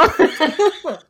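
a high, bursting laugh; real laugh. Real, not acted. Laughing at herself mid-story, real laughter. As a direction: let the laugh spill out. Laughs at own retold I-statement.

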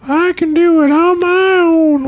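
put-on high, slow, quavering old man; Herbert voice. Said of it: "like Herbert from Family Guy".

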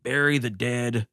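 ordinary talk

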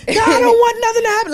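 high, wailing mock shout; loud, pleading mock wail; yelling at TV. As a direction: cry out in hammed-up distress at the screen. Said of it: "I be yelling at the T V".

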